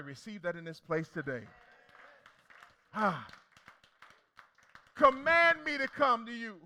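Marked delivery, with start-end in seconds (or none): 5.0-6.6 loud plea. Acted. Peter's plea quoted in sermon.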